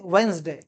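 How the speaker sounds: वेडनेसडे in two syllables, "WENZ-day", dropping low; correct pronunciation. Shown as a demonstration. Teacher repeats the correction, वेडनेसडे as "wenz-day". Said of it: "इसे हम पढ़ते हैं".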